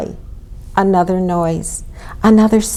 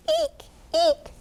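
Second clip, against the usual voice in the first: tiny, soft, very high squeaks; mouse squeak; 11 semitones higher, 8 dB quieter than usual. A narrator's reading. Mice scampering in the stable, soft high squeaks. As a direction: squeak softly like a little mouse. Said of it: "squeaked the scampering mice".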